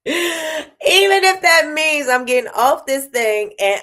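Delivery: high, slow, laughing through it; laughing repeat. Real, not acted. Vlogger repeats her nap joke, laughing high and slow.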